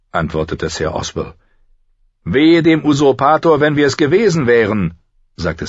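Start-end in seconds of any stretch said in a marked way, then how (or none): 2.2-4.9 high, loud knightly threat; defiant knight. Acted. Kingsguard knight warning Ned about the usurper, loudly defiant. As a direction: ring it out as a warning.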